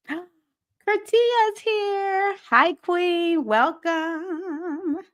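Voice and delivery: high, drawn-out gasp then sing-song welcome; light up for a friend joining; delighted greeting. Real, not acted. Gasps greeting new viewer.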